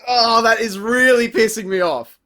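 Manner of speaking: ordinary talk, nothing marked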